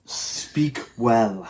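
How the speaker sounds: slow, grave mock-regal command; Loki voice. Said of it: "you doing Loki".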